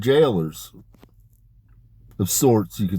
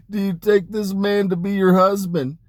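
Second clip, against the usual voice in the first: high, laughing wedding question; high and giddy at the courts of heaven; giddy laughing vow; 10 semitones higher than usual. Retold or imitated. Laughing while retelling Lilith's vow.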